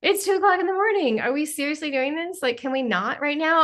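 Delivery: mock-annoyed at two in the morning; joking complaint to guides. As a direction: tease them like sleepy friends. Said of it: "I'm super funny with them".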